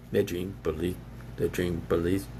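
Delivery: deep, soft backing-voice echo; low echo. Pretend. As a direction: drop way down and murmur the line back. Low echo of sung lyric.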